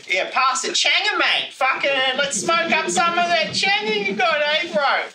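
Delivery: high, loud, broad ocker Aussie bloke; Bogan Aussie voice. Retold or imitated. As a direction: ham up a crude Aussie bogan offering a smoke. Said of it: "so it sounds like".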